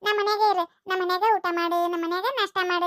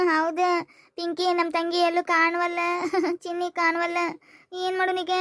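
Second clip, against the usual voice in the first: slow, tearful words broken by sobs; sobbing; 3 semitones lower, slower than usual. Acted. Girl telling friend her sister is missing, sobbing. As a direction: weep through the bad news. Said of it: "ನೀನೇ ಅಳೋದೇ ಸುಮ್ನೆ ಮಾಡು".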